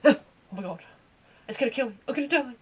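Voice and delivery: loud fast mock panic; retold panic. The rider, retelling her spooked horse, in mock panic. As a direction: gabble it out, half-joking terror. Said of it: "She got into the field and spooked".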